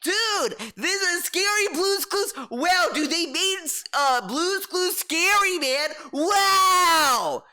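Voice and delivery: high, dopey dude voice, mocking; high goofy "wow dude" mockery; mocking dumb fan. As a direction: play a gushing clueless viewer. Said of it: "it was just him saying, like".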